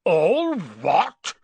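loud, high-rising outraged roar; thundered. A narrator's reading. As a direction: thunder it back in disbelief. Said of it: "Hagrid thundered".

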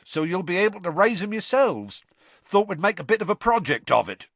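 eager giant showing off new pets; proud Hagrid. Acted. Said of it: "said Hagrid proudly".

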